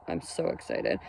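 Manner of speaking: a secret little thrill, whispered; excited whisper. Real, not acted. Repeats excited line as whisper.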